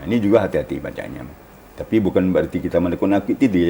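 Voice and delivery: usual speaking voice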